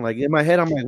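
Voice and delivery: normal talking voice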